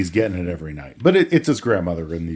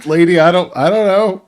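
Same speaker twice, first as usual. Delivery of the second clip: panicked; 8 semitones higher, 7 dB louder than usual. Retold or imitated. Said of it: "And he's like".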